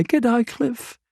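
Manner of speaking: lightly voiced, high and cheery hello; cheery greeting. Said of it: "that cheery voice".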